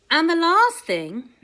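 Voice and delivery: usual speaking voice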